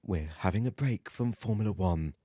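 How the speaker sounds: low, smooth classical radio voice; classical radio presenter. Pretend. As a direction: put on a late-night classical presenter. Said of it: "You sound like you're presenting Classical F M".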